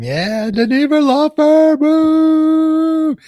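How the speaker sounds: high, loud, drawn-out cheer; hyped shout-out. Pretend. Host hypes the Niebuhr Law Firm, building whoop. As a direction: build to a long, whooping holler.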